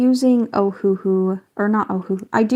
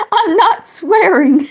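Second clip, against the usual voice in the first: laughing denial; 9 semitones higher, 7 dB louder, slower than usual. Real, not acted. Laughing denial to a chat viewer.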